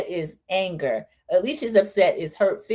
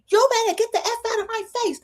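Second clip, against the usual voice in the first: much higher mock threat; imitating a yell. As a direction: mimic her warning, high and sharp. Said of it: "they were yelling at each other".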